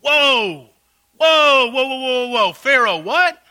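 mock astonishment. Pastor's mock shock at Pharaoh.

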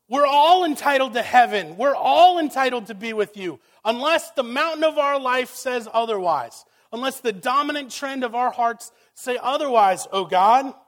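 loud, high, pleading preacher voice; impassioned preaching. Acted. Preacher voicing humanity's claim to heaven, loud and pleading. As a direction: plead hard on behalf of everyone. Voicing people's plea to God.

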